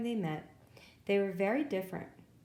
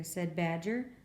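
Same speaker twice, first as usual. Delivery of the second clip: ordinary delivery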